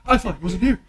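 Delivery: high, loud shouts; heated outcry. Acted. Player acting out a noisy accusation, high shouting. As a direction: cry out in high-pitched accusation. Acted accusing and killing cries.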